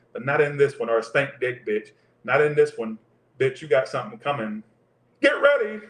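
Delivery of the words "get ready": high, loud call-out; hyped warning. Real, not acted. Tarot reader warning what's coming, hyped call-out.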